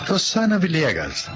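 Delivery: ordinary talk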